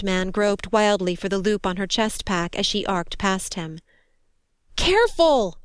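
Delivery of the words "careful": high, loud warning cry; shouted. A narrator's reading. Soldier warning the rescuer off her shield, shouted. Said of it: "Joni shouted".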